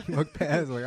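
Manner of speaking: usual speaking voice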